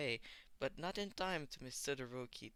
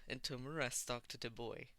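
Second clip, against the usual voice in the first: plain reading voice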